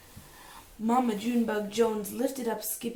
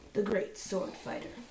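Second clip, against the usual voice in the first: usual speaking voice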